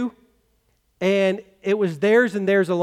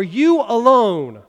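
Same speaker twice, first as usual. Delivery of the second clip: "you alone" slow and heavy, "you" punched louder; emphatic "you alone"; 5 dB louder, much slower than usual. Shown as a demonstration. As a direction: hammer "you" in "you alone", slowly. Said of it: "we would say".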